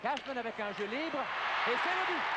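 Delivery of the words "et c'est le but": excited sports commentary. Real, not acted. Goal call on play-by-play.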